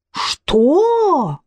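high, sharp indignant cry; indignant outcry. Acted. Кейси, offended he came for another woman, high outcry. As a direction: yelp it, offended. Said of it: "возмутилась Кейси".

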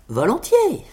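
high-pitched eager acceptance; tailleur empressé. A narrator's reading. (accord enjoué, voix perchée)

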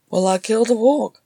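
lightly careless brag. A narrator's reading. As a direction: toss off the boast casually. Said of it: "he said carelessly".

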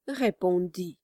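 usual speaking voice

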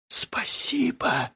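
slow, soft whisper. Acted. O Jongsu whispering thanks to Juwon. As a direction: breathe the thanks softly. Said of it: "прошептала".

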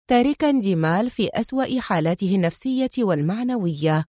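ordinary delivery

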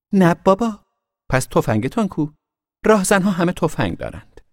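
put-on high, loud scoffing girl's voice; mocking girl. A narrator's reading. Girl on the swing scoffing at the boy's bandit claim. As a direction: tease him, unimpressed.

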